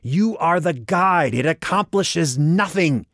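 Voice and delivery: high, drawn-out frustrated yell; near scream. A narrator's reading. Walker berating Vicus the guide, near scream. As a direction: yell it just short of screaming. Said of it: "Walker nearly screamed".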